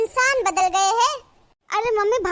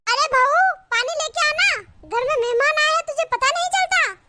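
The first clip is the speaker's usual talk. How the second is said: higher, sharp call across the house; 6 semitones higher than usual. Acted. Mother-in-law calls the bahu from the sitting room, scolding call. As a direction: summon the bahu from the kitchen, scolding.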